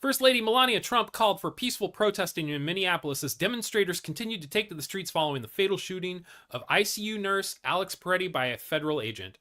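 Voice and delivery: usual speaking voice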